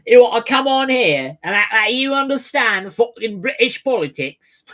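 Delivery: high, loud British scolding; indignant put-on British voice; mock British accent. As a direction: berate in an exaggerated British accent. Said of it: "I don't know what your actual accent is".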